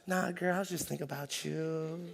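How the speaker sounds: hushed, put-on sweet-talk to a girlfriend; relationship voice. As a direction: drop to a soft, cooing boyfriend voice. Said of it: "this is my relationship voice".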